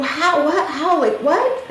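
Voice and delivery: high, stumbling questions in imitation; shocked. Daughter questioning mom about dad's death, shocked. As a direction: grasp for answers, pitch way up. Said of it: "shocked, you know, and she's like".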